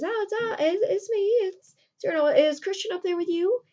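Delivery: very high, hesitant call up to a balcony; high-pitched play-acted innocence; feigned fresh arrival. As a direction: act innocent while calling up. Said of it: "just now arriving from a distance".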